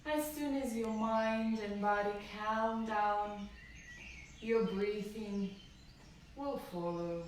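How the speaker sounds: normal talking voice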